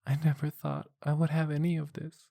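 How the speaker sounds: high, muffled, teary voice; muffled tearful woman. Acted. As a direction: speak into his chest, near tears. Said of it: "with a muffled voice".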